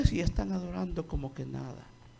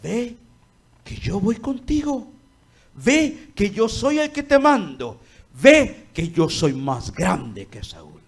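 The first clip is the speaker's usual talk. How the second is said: God reassuring, loud; 4 semitones higher, 7 dB louder, slower than usual. Acted. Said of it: "le está diciendo".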